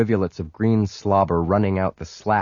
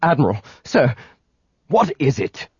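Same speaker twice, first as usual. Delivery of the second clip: higher and louder, alarmed aide; frightened; 5 semitones higher, 4 dB louder than usual. A narrator's reading. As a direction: burst in with alarm. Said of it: "eyes wide and frightened".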